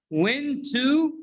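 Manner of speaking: "Went to" with a full, rising "too"; two full words, "Went to"; unreduced citation form. Shown as a demonstration. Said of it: "went to is pronounced went".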